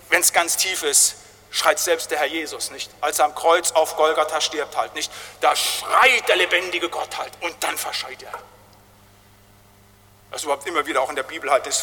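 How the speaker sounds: ordinary delivery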